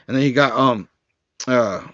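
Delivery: ordinary talk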